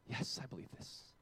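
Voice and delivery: soft whisper, slow; whispered answer. Retold or imitated. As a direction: breathe the answer softly. The dying boy's answer.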